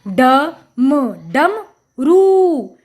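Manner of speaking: ड, म, डम, then a long रू, all separate; sounding out. Shown as a demonstration. Teacher showing children the pronunciation, piece by piece. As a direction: build it in pieces with pauses, stretching रू. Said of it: "हमने इसका उच्चारण किया".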